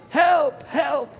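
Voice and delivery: high, loud cry for help; shouting for help. Retold or imitated. Man on the twentieth floor cries out, full shout. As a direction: yell help at the top of the voice. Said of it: "He was shouting, screaming".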